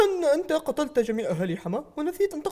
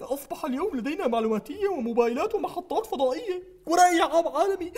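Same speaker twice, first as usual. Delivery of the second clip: usual speaking voice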